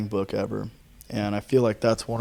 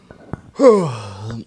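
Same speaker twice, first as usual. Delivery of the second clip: tired sigh; 6 dB louder than usual. Real, not acted. Tired sigh at day's end.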